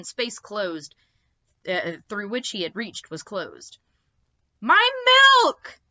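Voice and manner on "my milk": high outraged yell; shout. Acted. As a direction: cry out for the stolen milk. Milk snatched away.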